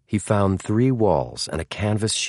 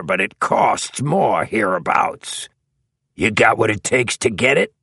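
raised, higher-pitched tall thug, hard-bargaining; tough drug dealer; 4 semitones higher, 3 dB louder than usual. A narrator's reading. Tall man sizing up an addict buyer, tough drug dealer. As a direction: talk like a street tough naming his terms.

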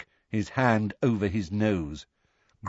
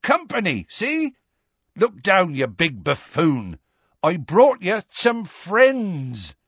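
higher, slow big-hearted coaxing; Hagrid calling; 8 semitones higher, slower than usual. A narrator's reading. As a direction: chide the big buffoon fondly. Hagrid voiced calling giant.

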